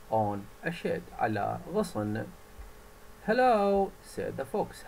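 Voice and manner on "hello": bright, loud fox hello; fox greeting. Acted. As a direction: call out cheerfully as the fox. (الثعلب يحيي الطير بصوت عالٍ)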